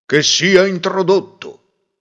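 slow, loud royal order; the king grants entry from his throne; regal command. A narrator's reading. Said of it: "disse il re". (ordine regale, lento e sonoro)

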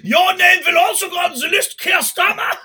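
mock military threat.

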